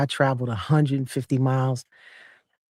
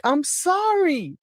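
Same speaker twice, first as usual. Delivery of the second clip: high, slow, whimpering sorry; new mother apologises to her own mom, half-joking; mock tearful apology; 13 semitones higher, much slower than usual. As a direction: beg her dead mother's pardon. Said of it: "I was like".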